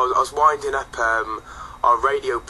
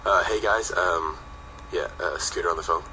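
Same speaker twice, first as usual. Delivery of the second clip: deadpan, low and soft, utterly unexcited; unconvincing; 8 semitones lower, 5 dB quieter than usual. Retold or imitated. Manager ringing the band with big news, flat and underwhelming. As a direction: mumble huge news like it's nothing. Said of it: "in the most unconvincing way possible".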